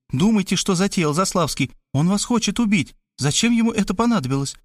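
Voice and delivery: high-pitched eager woman; breathless-to-explain chatter, lightly voiced. A narrator's reading. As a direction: rattle on excitedly in a lifted voice. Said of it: "обрадовалась и затараторила".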